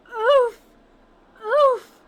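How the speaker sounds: high, very loud groans; pained oof. Real, not acted. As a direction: groan as if punched. Hit by emotional line.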